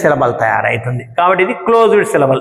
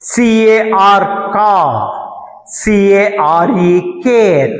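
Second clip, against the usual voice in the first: each letter spelt slowly, then the word; slow spelling demo; 3 semitones higher, much slower than usual. Shown as a demonstration. Teacher slowly spelling car and care for students. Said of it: "ఆర్ తర్వాత ఉ".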